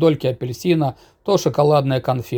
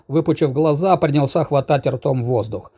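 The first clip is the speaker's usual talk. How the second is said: plain reading voice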